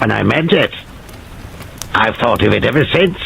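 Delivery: lightly voiced grown man turned childish; childlike voice. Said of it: "was becoming a child's voice".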